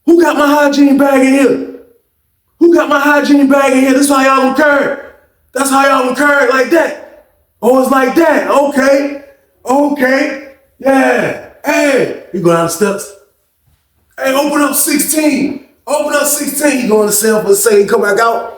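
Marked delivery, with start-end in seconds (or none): 0.0-6.0 furious repeated demand; angry shouting. Retold or imitated. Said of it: "he like". 14.2-16.8 calling out. Retold or imitated. Calling officer to open cell.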